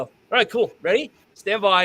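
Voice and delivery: high, loud range officer call; range officer command. Retold or imitated. Instructor calling a shooter to the line, high loud command. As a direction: bark the start commands up high. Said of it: "I just go over and go".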